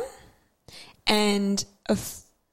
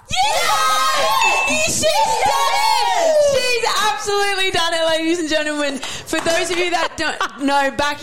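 triumphant announcer; 9 semitones higher, slower than usual. Real, not acted. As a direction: hype it like a ring announcer. Announcing her friend's spelling win.

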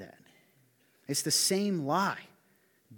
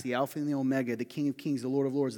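ordinary talk

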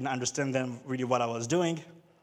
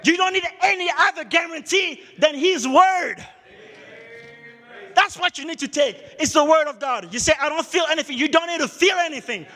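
loud, much higher, shouted sermon climax; preaching at full force; 11 semitones higher, 7 dB louder than usual. Acted. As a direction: drive it home to the congregation.

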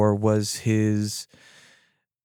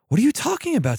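high-pitched, incredulous outburst without shouting; exasperated protest; 12 semitones higher, faster than usual. Acted. [name] protests in an acted quarrel.

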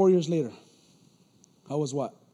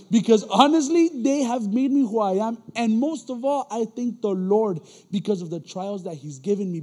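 loud, rising testimony, louder; preaching; 2 semitones higher, 6 dB louder than usual. Acted. Preacher testifying to the congregation, loud and fervent. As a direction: preach it out to the room.

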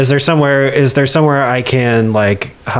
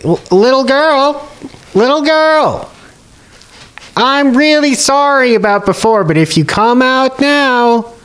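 amplified bartender imitation; 12 semitones higher than usual. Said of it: "imitate the voice of, the man at the bar; to make my voice louder".

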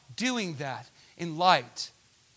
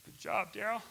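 mock self-praise; 6 semitones higher than usual. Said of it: "I could be just on myself".

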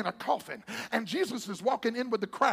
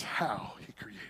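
whisper; 12 semitones lower, 7 dB quieter, slower than usual. Acted. Sudden whisper in sermon.

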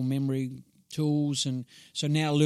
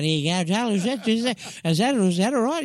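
high, loud, halting patter; pitched-up comic fluster; flustered shoe seller routine; 8 semitones higher, 7 dB louder than usual. Retold or imitated. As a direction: stammer through an acted, awkward fitting. Awkwardly serving old ladies, acted.

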